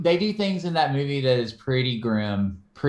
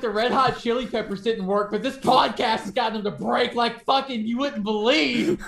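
higher-pitched, badly faked Serbian accent; bad mock Serbian accent, pitched up; terrible Serbian accent; 6 semitones higher than usual. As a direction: do a hammed-up Serbian torturer, accent more Russian. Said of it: "Was that a terrible Serbian accent?".

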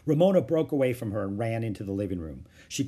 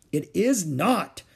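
slow, higher angry denial; 7 semitones higher, 4 dB louder, much slower than usual. A narrator's reading. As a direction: stamp it out word by word. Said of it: "said Beezus angrily, stamping her foot".

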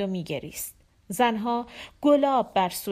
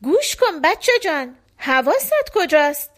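sharp, loud angry outburst, much higher; angry scolding; 7 semitones higher, 7 dB louder than usual. Acted. Father scolding his daydreaming little son, angry loud outburst. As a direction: snap at the child to pay attention.

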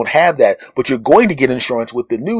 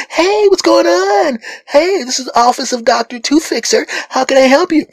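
bright and sunny receptionist; 13 semitones higher than usual. Retold or imitated. Dental receptionist answering the phone, bright and sunny. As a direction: chirp it like a perky receptionist. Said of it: "bright and sunny".

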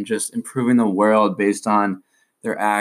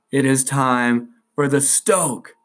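slow, drawn-out announcer call; big radio show intro voice; segment announcer; 5 semitones higher, much slower than usual. Acted. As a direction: hype up the segment title, stretched out. Announcing segment jingle-style.

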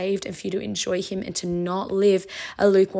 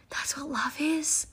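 slow whispered repeat, softer; awed whisper; 7 semitones higher, 5 dB quieter, slower than usual. Real, not acted. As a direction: hush it in wonder.

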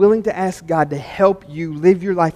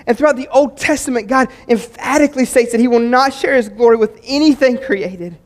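higher and louder, driving sermon climax; full-force preaching; 8 semitones higher, 9 dB louder than usual. Acted. Pastor urging his church to serve, preaching loudly. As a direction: preach it out to the whole room.

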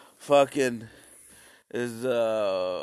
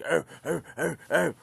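caveman grunts; 3 semitones higher than usual. Retold or imitated. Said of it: "Some people are, like, fighting".

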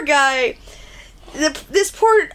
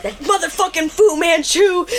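higher mock-angry outburst; mock anger; 7 semitones higher than usual. The boys cursing the poor trucker, higher mock anger. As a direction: curse him out in jest.

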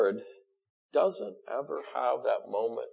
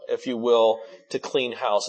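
usual speaking voice

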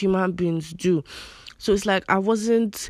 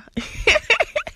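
squeaky high-pitched laugh; high giggle. Real, not acted. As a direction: burst into a high embarrassed giggle. Laughs at own accent.

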